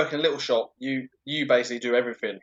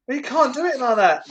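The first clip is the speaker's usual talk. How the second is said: indignant protest; 10 semitones higher, 3 dB louder than usual. Retold or imitated. Quoting own protest to manager.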